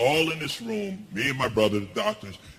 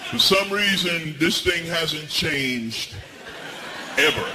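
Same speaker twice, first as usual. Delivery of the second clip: drawn-out emphasis; 3 semitones higher, slower than usual. Real, not acted. Slowed comic emphasis on gown.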